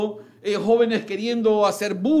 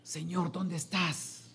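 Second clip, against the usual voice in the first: soft plea; 12 dB quieter than usual. Acted. Voicing a believer's prayer.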